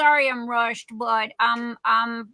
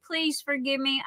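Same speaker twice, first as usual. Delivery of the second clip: ordinary delivery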